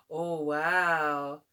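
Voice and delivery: plain reading voice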